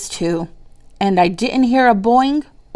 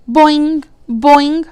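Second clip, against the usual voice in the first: frog twang; 7 semitones higher, 3 dB louder than usual. Acted. Narrator voices frog's twang.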